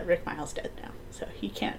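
hushed unvoiced aside; soft conspiratorial whisper. Real, not acted. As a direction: whisper it like a secret. Secretive aside.

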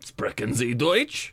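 put-on slow low German accent. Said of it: "keep doing the German accent".

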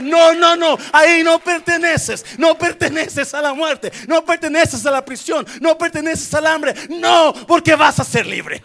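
loud, high, fired-up preaching; preaching at full force. Real, not acted. Pastor preaching freedom to congregation, loud and high. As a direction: shout it out and sing the promise to the church.

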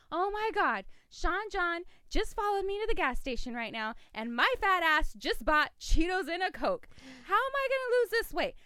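high, loud comic self-mocking rant; frantic phone rant. Woman venting to who she thinks is John, frantic rant.

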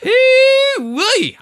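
very high, loud whooping hog call; pig call. Retold or imitated. Mocking Southern locals, loud shrill pig call. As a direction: whoop it out like calling hogs.

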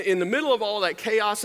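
ordinary delivery

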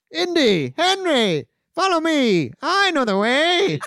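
high, loud mock rallying cry; excited calling out. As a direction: holler to companions in a comic high voice. Marcus Brody calling out from horseback.

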